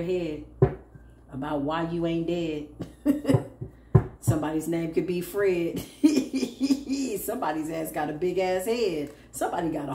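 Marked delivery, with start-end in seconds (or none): 2.7-3.7 short high-pitched giggle. Real, not acted. Amused at own rhyme. 5.9-7.2 high giggle trailing down. Real, not acted. Amused at name joke.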